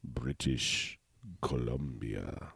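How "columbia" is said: low, slow, soft announcer drop; lowered DJ voice; dramatic announcer. Real, not acted. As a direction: drag it out deep like a movie trailer. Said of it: "I don't know why I said it like that".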